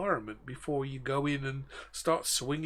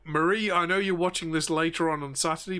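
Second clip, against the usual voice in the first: ordinary delivery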